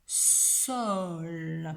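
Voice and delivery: "Sol" slow, long s and held l; sounding out. Shown as a demonstration. Teacher shows three sounds to pupil, "Sol" stretched slowly. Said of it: "il y a également trois sons".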